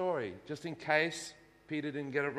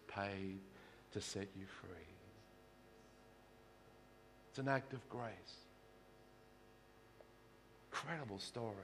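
hushed reverence; 6 semitones lower, 14 dB quieter than usual. Acted. Pastor quietly reminding the congregation of Jesus' price, hushed. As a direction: drop to a near hush, let it sink in. Hushed reflection after climax.

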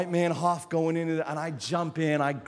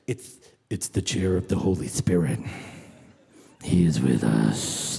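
solemn reply; 11 semitones lower, 7 dB louder than usual. Acted. Businessman answering who sits in the empty chair, deep and solemn. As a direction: answer with grave, booming reverence.

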